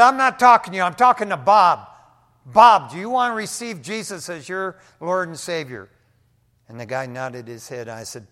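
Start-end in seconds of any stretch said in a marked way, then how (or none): 0.0-5.9 loud, stern rebuke, then the question; firm rebuke. Retold or imitated. Pastor rebuking the demon and speaking to Bob.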